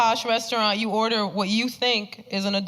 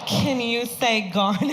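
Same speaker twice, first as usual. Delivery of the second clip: talking down as if to an idiot; 4 semitones higher, slower than usual. Retold or imitated. Boyfriend's parents talking down to her, slow and patronizing. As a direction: spell it out like to a toddler, mockingly. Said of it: "they were like".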